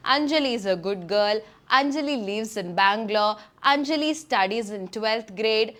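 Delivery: very slow, each sentence spelled out one by one; slow repetitive demo. Shown as a demonstration. Teacher demonstrating repetitive sentences about Anjali, very slowly. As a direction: read it out like a dull, slow list. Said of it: "അപ്പം ഞാൻ പറയാണ്".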